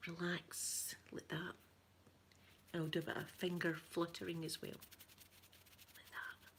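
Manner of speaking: soft bedtime ASMR whisper. Acted. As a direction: lull the viewer Tony to sleep, gently. ASMR sleep send-off to viewer.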